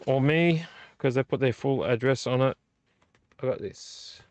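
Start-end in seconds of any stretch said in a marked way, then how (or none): 3.4-4.2 whispered aside. Real, not acted. Quiet aside.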